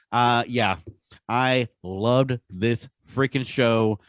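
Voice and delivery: ordinary delivery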